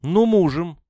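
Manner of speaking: high, loud challenge; defiant. A narrator's reading. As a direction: throw it back at them, daring them. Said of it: "с вызовом ответила Надежда". (громко, с вызовом)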